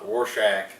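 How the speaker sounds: usual speaking voice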